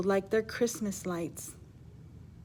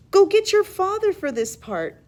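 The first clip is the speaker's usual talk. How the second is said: much higher and louder, calling out; mother calling; 10 semitones higher, 8 dB louder than usual. Acted. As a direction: call across the house like a mom. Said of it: "she said".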